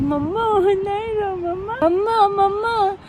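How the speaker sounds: imitating crying toddler. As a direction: mimic the toddler begging mum not to cry. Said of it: "She's like".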